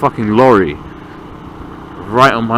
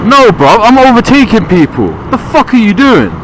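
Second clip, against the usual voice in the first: angry retort; 8 semitones higher than usual. Real, not acted. Shouting back at the trucker.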